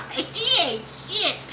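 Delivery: high laugh, slow words; laughing through it. Real, not acted. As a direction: crack up while judging him. Laughing at Kanan's taunt.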